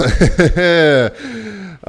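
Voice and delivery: high, loud laugh trailing downward. Real, not acted. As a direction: burst out laughing after the outburst. Laughs at own outburst.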